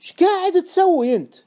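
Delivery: high, loud disbelief; astonished question. Acted. Friend seeing the short-sale order, astonished. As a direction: demand it in shocked astonishment. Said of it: "سألني صاحبي باستغراب".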